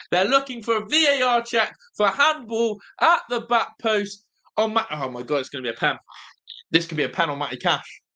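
ordinary talk, nothing marked

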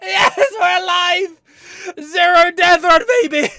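loud victorious whoop; elated cheer. Real, not acted. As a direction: celebrate surviving loudly. Celebrating survival.

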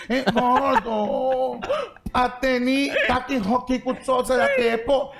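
high, drawn-out nagging wheedle; whining plea. Acted. Wife pestering her husband about the hair, whining. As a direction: stretch it into a pestering whine.

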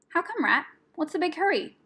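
high, lively taunting question; cheeky high-voiced brownie; teasing brownie. A narrator's reading. Brownie teases rat.

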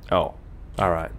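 ordinary delivery

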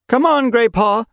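high calling voice. A narrator's reading. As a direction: call across to the apprentice. Bright Sky calling her apprentice.

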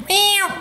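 very high mock meow; cat meow. Cat fleeing down the stairs, high meow. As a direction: squeal a cat's meow.

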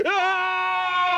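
high scream while falling. Acted. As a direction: cry out as you drop. Falls into the pit. (Schrei im Fallen)

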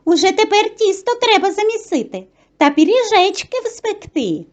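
high-pitched happy mice; delighted. A narrator's reading. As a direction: chime in gladly, high-voiced. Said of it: "радіють мишенята".

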